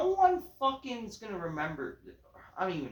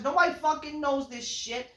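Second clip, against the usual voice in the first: ordinary delivery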